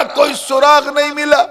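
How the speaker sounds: very high, ringing despair at सुराग नहीं मिला; impassioned cry. A narrator's reading. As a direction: proclaim the fruitless search at high pitch. Dramatic take, then subdued repeat. (ऊँचे सुर में हताश पुकार)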